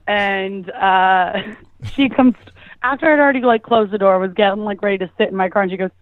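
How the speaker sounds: ordinary delivery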